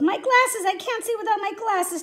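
high, urgent plea, loud at first; high-pitched, desperate cry for help; pleading. A narrator's reading. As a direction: beg in distress. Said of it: "Tom pleaded".

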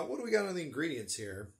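ordinary delivery

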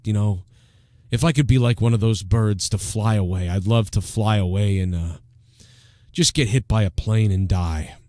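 Opie. Acted. Opie watching birds outside his house, low listless musing. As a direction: muse in low mock despair. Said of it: "back to Opie".

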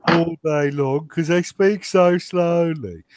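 put-on slow, drawn-out Birmingham accent; exaggeratedly slow Brummie. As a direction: drag it out like a mock Brummie. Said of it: "you've got to be a Brummie then".

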